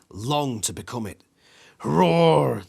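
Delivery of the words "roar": big loud roar, pitched up; dinosaur roar. A narrator's reading. The T-Rex roars inches from your nose. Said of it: "The T-Rex screams with rage".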